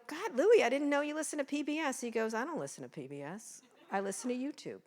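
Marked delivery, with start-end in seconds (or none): 0.1-2.0 put-on mother delighted her nineteen-year-old son listens to PBS; amazed mom. 2.3-4.8 deadpan son. Retold or imitated.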